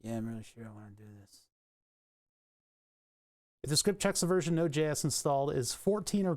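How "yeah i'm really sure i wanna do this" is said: low, quick, very soft mutter; muttered mock reply. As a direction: mumble a weary answer under your breath, fast. Answers the voiced prompt.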